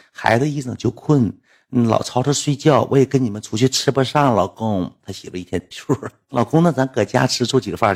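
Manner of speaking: ordinary talk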